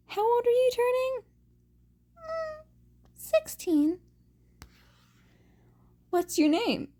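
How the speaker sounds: put-on high, quick chirpy teen; giddy concussed chatter; character voice. Dizzy teen banters.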